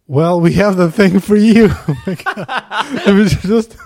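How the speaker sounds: loud, hyped pitchman voice; hammed-up ad voice. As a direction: launch into the sponsor like a showman. Launching into sponsor read, laughing.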